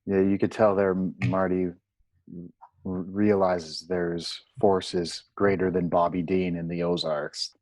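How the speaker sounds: ordinary delivery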